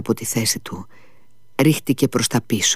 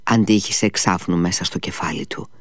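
usual speaking voice

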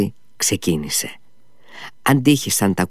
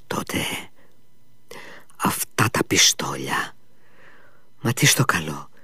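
hushed, lower worried whisper; 6 semitones lower, 7 dB quieter than usual. Acted. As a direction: breathe it to yourself. Ο υπάλληλος σκέφτεται φοβισμένα. (ψιθυριστά, ανήσυχα)

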